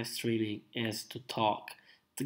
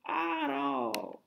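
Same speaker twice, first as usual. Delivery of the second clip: mock cry; 6 dB quieter than usual. Mock sob after self-deprecation.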